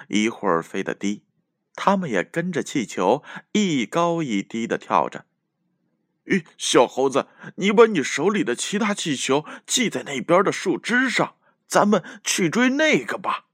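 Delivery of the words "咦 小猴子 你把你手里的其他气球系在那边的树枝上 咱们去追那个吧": panting little bear, high voice; out of breath. A narrator's reading. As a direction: gasp it out after the chase. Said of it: "气喘吁吁地说".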